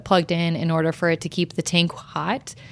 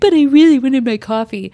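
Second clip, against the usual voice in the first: higher, louder mock whine; pouting; 5 semitones higher, 6 dB louder than usual. Retelling her coffee tantrum, hammed-up pouty whine. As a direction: whine like a sulky kid. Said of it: "I'm just basically pouting".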